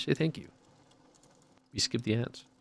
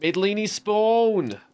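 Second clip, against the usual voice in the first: excited shout-out; 12 semitones higher, 5 dB louder than usual. Real, not acted. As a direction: shout the donor's name with joy. Streamer thanks gifted subs.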